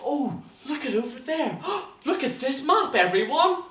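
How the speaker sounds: high-pitched gasping wonder; gasp, then exclaim in a high voice; acted amazement. Shown as a demonstration. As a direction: point things out with dramatic surprise. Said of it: "That's your gestures".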